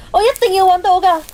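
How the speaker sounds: fast, loud and frantic; desperate outburst. Acted. Zilin vowing through tears to find her aunt's hairclip, loud and fast. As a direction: push the words out fast, fighting panic.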